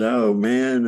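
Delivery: low, choked-up near tears; a retold near-tearful groan. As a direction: sound close to crying, voice dropping. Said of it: "Almost like on the verge of tears".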